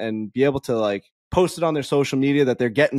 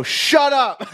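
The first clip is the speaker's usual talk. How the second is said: firm shut up; 11 semitones higher than usual. Retold or imitated. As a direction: snap it straight at him. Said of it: "I just go".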